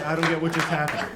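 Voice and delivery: usual speaking voice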